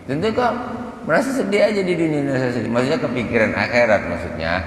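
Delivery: mock his constant smiling with disdain; scornful scolding. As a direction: mentor mocking a pupil's cheerfulness, scornfully. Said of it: "kata Sayyidah Rabi'ah".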